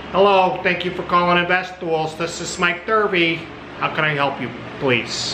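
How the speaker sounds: put-on high, loud Chicago-accented phone greeting; Mike Thurvey. Old friend answering a business call, loud Chicago accent. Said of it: "He always talks like this when he answers the phone".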